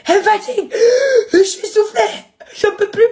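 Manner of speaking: high whiny mock of father. Mimicking her father complaining to the social worker, whiny and high. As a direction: whine it like a worn-out parent. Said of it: "Il fait ça".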